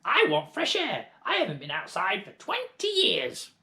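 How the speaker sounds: put-on high, loud old hag; crone shout. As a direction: demand fresh air. Grandma shouting.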